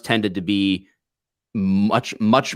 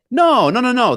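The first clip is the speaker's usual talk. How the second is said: high, loud protesting noes; insistent denial; 13 semitones higher, 5 dB louder than usual. Retold or imitated. New recruit correcting the cops who warn him, insistent denial. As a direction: brush the warning off in disbelief. Disbelieving reply to friends' warning.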